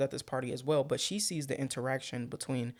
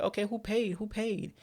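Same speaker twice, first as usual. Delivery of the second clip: pressing question; 8 semitones higher than usual. Retold or imitated. Chris quote, raised pitch.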